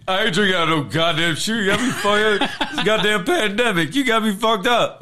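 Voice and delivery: high-pitched comic outrage; Derek Lewis impression. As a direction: play an indignant fighter refusing, hammed up. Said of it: "if Lewis was like".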